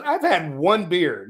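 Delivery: slow, emphatic "I've had one beer"; slow stressed protest. Retold or imitated. Telling the girlfriend he's sober, slow and stressed. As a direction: lean on each word slowly. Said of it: "I said".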